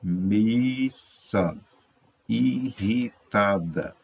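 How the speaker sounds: MISSA and IRRITADA drawn out slowly; syllable by syllable. Shown as a demonstration. Teacher reading out Missa, irritada, syllable by syllable. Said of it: "Leia as palavras dos quadros a seguir".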